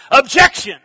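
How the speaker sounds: forceful one-word protest; courtroom objection. Acted. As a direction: throw it out across the courtroom.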